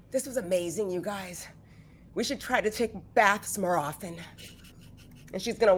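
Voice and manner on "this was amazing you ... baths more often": soft, sighing contentment; contented and soft. Acted. Feruza to her companions after the bath, softly contented. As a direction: say it softly after a relieved sigh.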